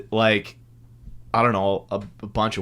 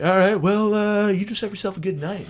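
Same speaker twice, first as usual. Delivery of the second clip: high-pitched imitation of a cop; cop role; 11 semitones higher, 4 dB louder than usual. Cop letting the driver go, mock cop voice. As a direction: send him off in a put-on officer voice. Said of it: "And then he's like".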